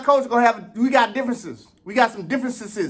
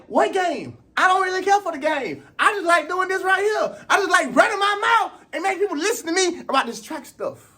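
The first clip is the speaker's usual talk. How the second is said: passionate outburst; 8 semitones higher than usual. Retold or imitated. Vlogger telling his subscribers why he really does this, crying out. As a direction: pour your heart out about loving the track talk. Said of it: "And I'm like".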